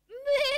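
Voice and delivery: high, loud imitated baa; sheep bleat.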